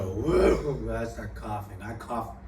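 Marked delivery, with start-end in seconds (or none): 0.0-0.9 dazed drawn-out whoa; stunned whoa. Retold or imitated. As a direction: let out a stoned whoa. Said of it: "I just go".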